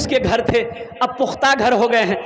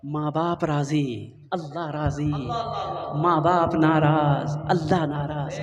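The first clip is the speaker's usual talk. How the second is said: solemn maxim; 6 semitones lower, 6 dB quieter than usual. Acted. Preacher intones a maxim.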